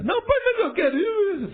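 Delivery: very high mock-tearful whine; child left behind at the door, mock crying to go along. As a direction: play a kid sobbing at the door. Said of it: "Fica chorando lá na porta".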